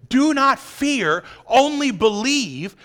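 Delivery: slow. Acted. Quoting Jesus, slowed.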